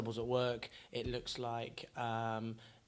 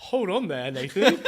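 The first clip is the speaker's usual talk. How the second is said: posh English voice; 6 semitones higher, 14 dB louder than usual. Pretend. Playing along in posh voice.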